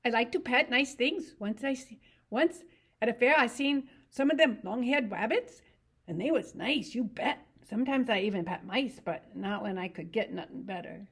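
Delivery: Lenny. Acted. To Curly's wife about rabbits, eager simple voice.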